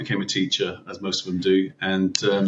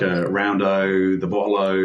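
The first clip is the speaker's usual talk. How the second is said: slow, drawn-out Aussie "round O", "bottle O"; Aussie slang demo; slower than usual. Shown as a demonstration. Podcast guest shows how Aussies end every word with O, slowly. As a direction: spell out the "O" endings in a slow Ocker drawl. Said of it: "put an O on the end of everything".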